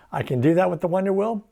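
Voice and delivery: ordinary talk, nothing marked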